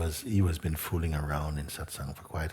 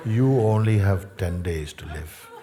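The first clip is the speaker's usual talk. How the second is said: loud verdict; 2 semitones higher, 8 dB louder than usual. Retold or imitated. Master pronounces the verdict.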